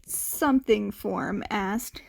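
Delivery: usual speaking voice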